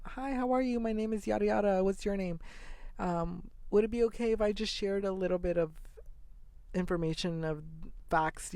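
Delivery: imitating a chirpy petition pusher; pushy canvasser. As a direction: play the eager street canvasser hooking a stranger. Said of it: "and they're like".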